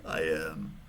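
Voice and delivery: very low-pitched, unhurried admission; retold deadpan admission. Minivan driver owning up to tow truck man, low deadpan. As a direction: own up in a deep, low voice.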